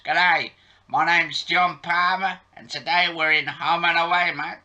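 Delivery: put-on Australian accent. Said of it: "I love the accents".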